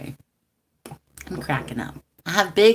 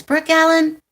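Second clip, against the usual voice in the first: calling out high and loud like her auntie; 10 semitones higher, 8 dB louder than usual. Retold or imitated. Auntie calls her niece's name, high and loud.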